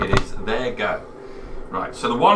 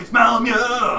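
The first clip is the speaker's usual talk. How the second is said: calling out in character; 10 semitones higher, slower than usual. Acted.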